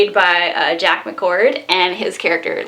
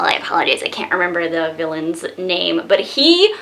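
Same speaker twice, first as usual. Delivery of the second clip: ordinary talk, nothing marked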